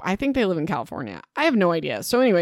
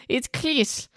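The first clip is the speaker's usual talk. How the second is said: higher, badly attempted German accent; 6 semitones higher than usual. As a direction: have a clumsy go at a German accent. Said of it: "how to say it in, with a German accent".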